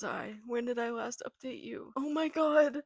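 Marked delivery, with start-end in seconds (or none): 2.0-2.8 high, loud wail of dismay; real high-pitched distressed shout; tearful outcry. Real, not acted. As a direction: cry out, overwhelmed. Overwhelmed, crying over the book.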